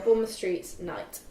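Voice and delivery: normal talking voice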